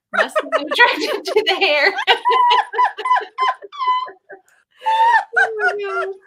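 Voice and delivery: loud burst of laughter through the words; laughing. Real, not acted. Host laughing hard at her funny cat story. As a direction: laugh out loud while finishing the line.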